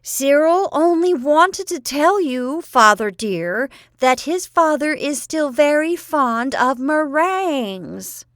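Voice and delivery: slow, high, loud bawl; aunt bawling the message at her father. A narrator's reading. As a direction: bawl every word at the deaf old man. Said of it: "really bawled at Grandfather Pinner".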